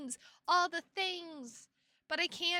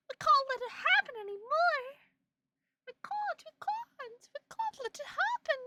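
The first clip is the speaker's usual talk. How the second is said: high squeaky alarmed little voices; very high, hammed-up panicky little character; little voices in the head; 11 semitones higher than usual. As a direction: squeak it out in a tiny put-on voice. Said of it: "all my little voices are coming out".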